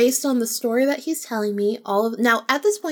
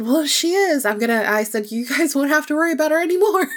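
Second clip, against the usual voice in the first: cocky boast; 6 semitones higher, 5 dB louder, faster than usual. Retold or imitated. Retold boast to guest about spirit.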